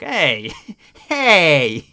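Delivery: loud hey through a laugh; laughing shout. Real, not acted. Streamer yells at chat teasing about his accent, laughing. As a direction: yell it out while laughing.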